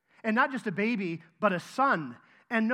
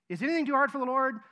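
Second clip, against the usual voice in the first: quick, higher and louder challenge; rapid-fire question; 6 semitones higher, 5 dB louder, faster than usual. Acted. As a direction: fire off the question in one rush. Rapid restaging of question before answer.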